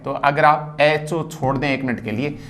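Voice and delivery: ordinary talk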